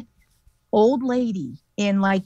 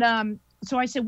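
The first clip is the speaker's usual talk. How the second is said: ordinary delivery, nothing marked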